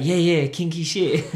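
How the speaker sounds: loud, high, slow gleeful agreement as the French friend; eager yes. Retold or imitated. French friend cheerfully confirming the party, loud and drawn out. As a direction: drag out a delighted yes, yes with a French accent.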